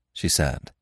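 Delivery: ordinary delivery, nothing marked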